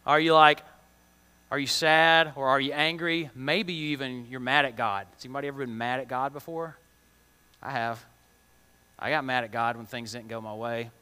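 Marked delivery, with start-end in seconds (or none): none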